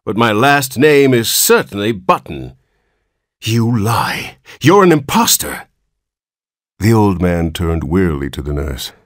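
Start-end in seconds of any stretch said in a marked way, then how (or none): 0.0-2.5 put-on high-pitched old man's complaint, easing down; querulous whine. A narrator's reading. Newborn old man claims the Button name, querulous whine. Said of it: "replied the querulous whine". 3.4-5.7 angry accusation. A narrator's reading. Father accuses the old man.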